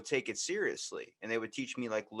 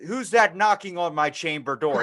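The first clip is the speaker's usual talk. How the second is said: loud, raised theatrical recital; Shakespearean baby; 6 semitones higher, 11 dB louder than usual. Pretend. Newborn absurdly reciting to the room, mock Shakespearean declaiming. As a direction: declaim it grandly like stage verse. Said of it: "the playwrights of Shakespeare".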